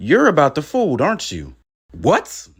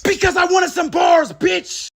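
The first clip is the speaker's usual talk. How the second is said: angry shout; 14 semitones higher, slower than usual. Acted. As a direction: snap at the rapper with full force. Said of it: "I apologize for raising my voice like that".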